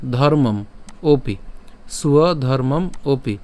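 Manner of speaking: সুয় ধর্মম ওপি said slowly, syllable by syllable; slow joined demo. Shown as a demonstration. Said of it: "আমরা একসাথে উচ্চারণ করবো".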